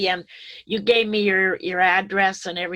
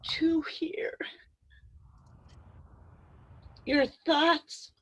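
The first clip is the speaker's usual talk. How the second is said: high, slow, breaking into a whisper through tears; fighting back tears as she thanks them; tearful, voice breaking; 6 semitones higher, 4 dB quieter, slower than usual. Real, not acted. Thanking the Zoom group, choked up with tears. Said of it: "I'm getting really emotional here".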